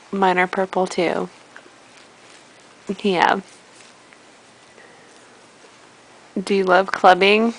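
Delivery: low, laid-back party-guy voice; deep dude voice. Pretend. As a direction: drop way low like a club bro chatting up the baby. Deep voice chatting to baby.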